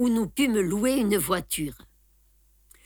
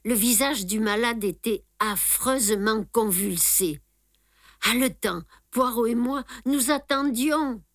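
ordinary delivery, nothing marked

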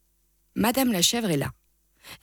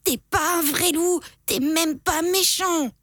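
put-on bawling kid; 8 semitones higher than usual. A narrator's reading. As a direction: bawl it like a brat. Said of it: "et braille".

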